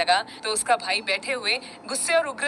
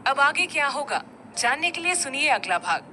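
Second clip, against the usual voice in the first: ordinary delivery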